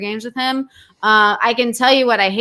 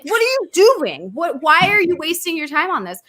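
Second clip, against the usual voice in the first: higher-pitched, incredulous questioning; exasperated scolding; 6 semitones higher than usual. Retold or imitated. Scolding partner wasting his night on cheaters, exasperated. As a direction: plead in disbelief, stretching the question.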